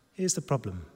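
ordinary talk

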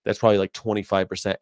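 ordinary talk, nothing marked

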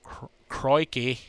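mock Aussie accent, drawn out; bad Australian accent. Podcaster jokes about a download from Australia, bad Australian accent. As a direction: put on a hammy, badly faked Australian accent. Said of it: "bad accent".

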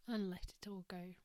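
soft whispered meditation voice; guided meditation. Acted. Leads a breathing meditation.

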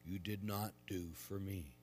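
measured, solemn proclamation; quiet liturgical reading; gospel reading. Acted. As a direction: read scripture aloud at the altar.

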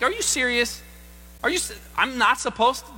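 as if you can't believe the rule; mock disbelief.

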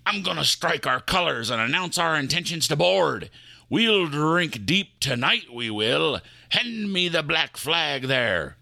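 put-on eager pirate accent, lightly done. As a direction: prepare to board the van in a pirate accent.

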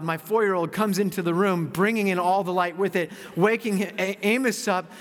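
ordinary delivery

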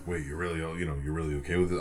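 lightly imitated low rock-star voice. Singer checking with a fan, low imitation. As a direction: drop it low and soft, a half-attempted impression. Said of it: "I can't replicate his voice".

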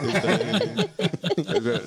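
high loud laugh breaking out; real uncontrolled laugh; loud burst of laughter. Real, not acted. Laughs at stolen car story.

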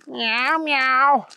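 high mewing kitten voice; cat meow. Acted. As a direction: mew it like a small cat. Cat answers agent.

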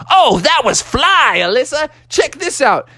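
put-on high, swooping hyped-up kid voice; excited admiration. Bubby impressed by sister's stunt, excited high voice. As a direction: cheer it, showing off for your sister.

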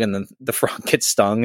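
ordinary talk

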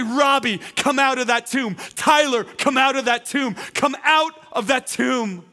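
loud, high summons building to a slow final call; full-force call, last one drawn out; commanding voice. Acted. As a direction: preach it as a shouted order to the dead. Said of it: "commanding voice of the authority".

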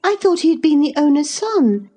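put-on high, haughty girlish chatter; a little lady offended by a servant; spoiled young girl. A narrator's reading. Cathy complains to the housekeeper, haughty and girlish.

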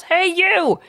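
high-pitched mock shout, as if calling out; acted yell. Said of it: "Scott runs outside and yells".

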